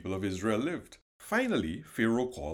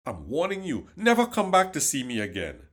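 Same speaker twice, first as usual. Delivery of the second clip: stern warning; 4 semitones higher, 6 dB louder than usual. Acted. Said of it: "Pharaoh shouted at Moses".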